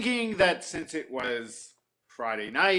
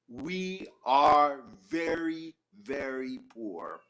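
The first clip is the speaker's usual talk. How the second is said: mournful sigh of a poor villager; slow lament; much slower than usual. Acted. The shamus tells the beggar sadly that the town is very poor. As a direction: draw out very, very poor slowly. Shamus pleads poverty slowly.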